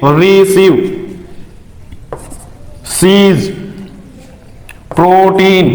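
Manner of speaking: रिसीव, सीज, प्रोटीन each with a held ee; word-by-word demo, stretching the ee in रिसीव, सीज, प्रोटीन; long ee. Shown as a demonstration. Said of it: "इसमें capital ई निकल".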